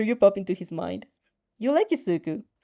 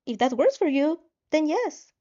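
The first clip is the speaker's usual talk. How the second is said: higher, brighter voice for Izuku; Izuku's message; 7 semitones higher, 3 dB louder than usual. Acted. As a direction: read Izuku's text a bit higher and louder. Izuku confirms meeting.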